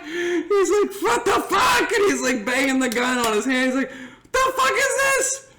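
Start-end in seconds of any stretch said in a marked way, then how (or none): 1.0-1.9 high-pitched mock furious shout; imitated outrage. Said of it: "he's so mad". 3.9-5.5 slow, very high mock fury; imitated outrage. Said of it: "he's so mad".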